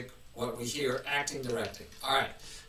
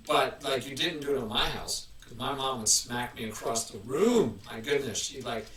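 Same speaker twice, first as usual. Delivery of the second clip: ordinary delivery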